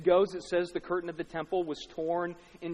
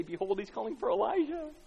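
higher, mock-amazed call; 6 semitones higher than usual. Bystanders jeering at Jesus on the cross, mocking. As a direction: jeer it lightly with a lift at the end. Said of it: "It's mockery again".

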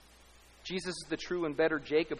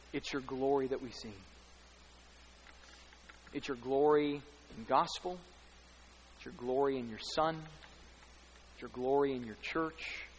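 prayer. Acted. Pastor leading the congregation in prayer.

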